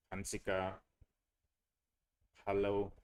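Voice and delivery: normal talking voice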